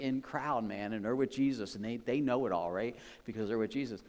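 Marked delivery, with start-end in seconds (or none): none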